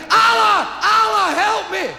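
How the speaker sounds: very high yell to Allah; panicked shout. Acted. Parachutist plummeting, shouting to Allah in panic. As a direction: scream the prayer while falling. Falling man calls on Allah.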